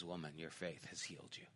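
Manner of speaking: hushed, low and quick; tender reassurance. Retold or imitated. Jesus speaks to the weeping healed woman, hushed and tender. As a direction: reassure her very softly.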